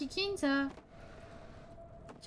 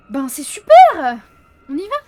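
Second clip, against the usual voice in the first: real yell; 4 semitones higher, 23 dB louder than usual. Real, not acted. Gamer yelling too close to her mic, loud sarcastic shout. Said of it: "j'ai gueulé un peu fort".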